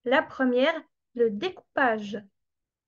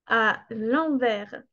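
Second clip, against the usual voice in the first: slow clear teaching; slower than usual. Shown as a demonstration. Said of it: "ça vient du terme à l'envers".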